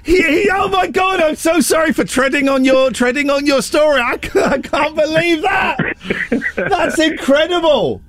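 very high, shouted disbelief; astonished delight. Real, not acted. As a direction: squeal it out, thrilled at guessing right. Guessed the story's punchline, astonished.

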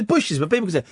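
usual speaking voice

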